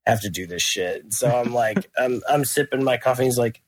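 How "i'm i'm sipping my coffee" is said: ordinary delivery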